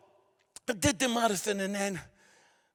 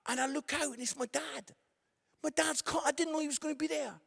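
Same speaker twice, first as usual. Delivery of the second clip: ordinary delivery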